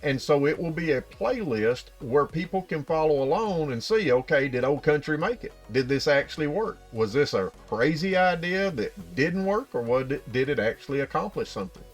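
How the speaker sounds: ordinary talk, nothing marked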